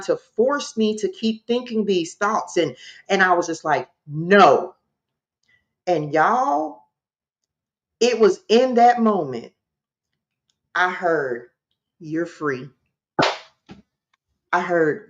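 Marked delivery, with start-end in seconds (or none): 4.1-4.8 louder, firm retold refusal; power and authority. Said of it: "no with power and authority". 12.0-12.7 low, hushed retold message; soft heard voice. Retells a voice she heard.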